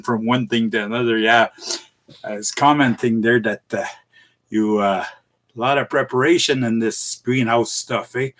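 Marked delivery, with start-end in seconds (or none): none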